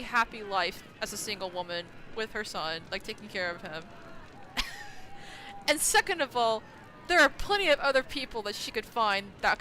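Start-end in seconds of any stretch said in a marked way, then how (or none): 3.9-5.6 airy silent laugh through the nose; a breathy laugh of disbelief; unvoiced laugh. Real, not acted. Streamer laughing after rant.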